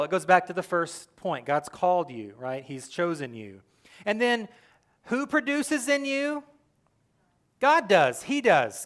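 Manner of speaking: ordinary talk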